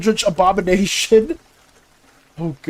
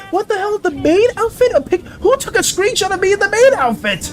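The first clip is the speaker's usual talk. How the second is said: higher, embarrassed outburst; flustered shock; 7 semitones higher than usual. Real, not acted. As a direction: caught off guard by the photo. Finds maid outfit screenshot.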